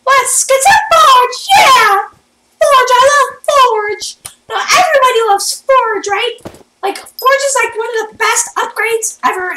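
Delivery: high, loud goofy weirdo hype. Pretend. Gamer hyping the Forge upgrade to everyone, weirdo voice. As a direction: squeal it out like an over-hyped weirdo. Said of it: "talking like a weirdo".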